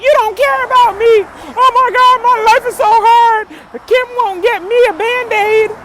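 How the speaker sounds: high, loud, whining imitation of a sulking husband; mock him as a crybaby; hammed-up childish complaining; mock whiny tantrum. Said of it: "was he screaming and shouting".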